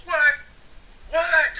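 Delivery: high, loud double-take; sharp rise in volume and pitch, disbelieving; astonished. Retold or imitated. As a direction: imitate a stunned daughter exclaiming. Daughter's astonished reaction, acted.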